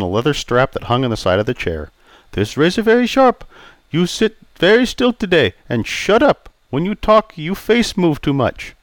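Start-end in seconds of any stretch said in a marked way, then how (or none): 2.3-8.7 put-on raised, accented old barber voice; polite Chinese-accented barber greeting. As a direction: voice Pei the barber, courteous. Pei orders.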